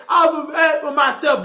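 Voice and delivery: shouted high mock quote; mocking her words. Mocking the woman's claim to provide for herself, mock shout. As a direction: throw her boast back mockingly. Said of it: "How you say".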